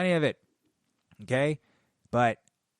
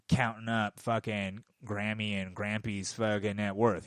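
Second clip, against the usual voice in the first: normal talking voice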